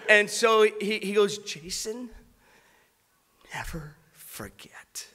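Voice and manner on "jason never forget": whispered, slow and tender through tears; soft whispered plea, almost breaking; tearful whisper. Retold or imitated. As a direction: breathe it out like a crying friend. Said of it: "he's already crying".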